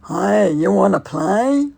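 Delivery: loud, drawn-out creepy clown call; imitated clown shout. Clown shouting up at the window, loud slow call. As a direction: holler it slowly like a menacing clown. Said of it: "this clown was, was shouting".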